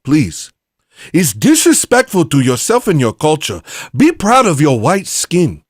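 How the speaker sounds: high, loud put-on African accent. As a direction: preach emphatically in a mock African accent. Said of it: "I would use an African accent".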